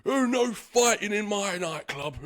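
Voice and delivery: a hint of an angry guard, pitched high; mock-stern bouncer telling them off; security guard. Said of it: "He looked very angry".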